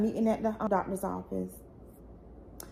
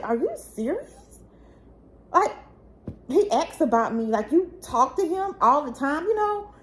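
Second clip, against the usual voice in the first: high, stunned disbelief; can't believe what auntie just said; shocked disbelief; 7 semitones higher, 4 dB louder than usual. Retold or imitated. To her aunt on the phone, stunned and incredulous.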